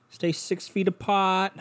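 high, drawn-out parroting of a rule; mimicking a slogan. Vlogger parroting the social distancing rule, mocking singsong. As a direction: recite it slowly in a put-on high voice.

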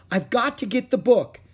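high, loud, determined call. A narrator's reading. Jack to Annie, going back for the book, calling out. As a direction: shout back your reason urgently. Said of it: "Jack called".

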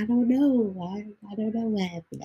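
a high, mildly whiny "I don't know", imitating her; mock clueless whine. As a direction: play innocent, a lightly voiced confused girlfriend. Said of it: "That's the first thing she always say".

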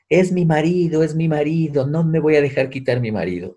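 high, shrill-free mock catfight cry; peleándose por el marido. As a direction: mimic a jealous man fighting over his husband. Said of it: "y el uno le decía". (imitando a un chico celoso que grita por su marido)

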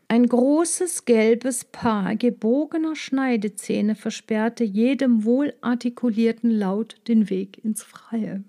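ordinary delivery, nothing marked

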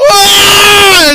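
high loud mock scream of terror; terrified scream. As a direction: scream as the train roars past. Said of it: "he's just screaming".

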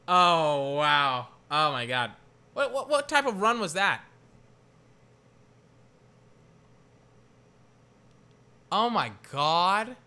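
loud stunned oh my God; excited disbelief. Real, not acted. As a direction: blurt out amazed and loud. Reacts to a wild run.

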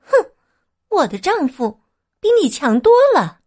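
scoff, then boast proudly; disdainful. A narrator's reading. Acai turning down the emperor, disdainful. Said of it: "阿彩不屑地说".

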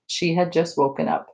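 ordinary delivery

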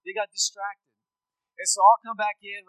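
ordinary talk

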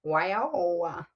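"Wow" drawn out with extra vowels at the end; vowels added on. Retold or imitated. Showing how cousins add syllables, "Wow" with trailing vowels. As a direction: stretch "Wow" into several country syllables. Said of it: "putting the vowels at the end".

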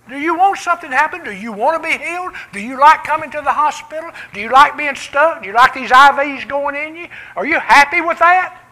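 loud, high, fast-pressing questions; challenging questions. Retold or imitated. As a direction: push him to want healing. Retold challenge to hospital patient.

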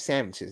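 "sandwiches" as SAM-itches, dropped low; slang pronunciation of sandwiches. Shown as a demonstration.

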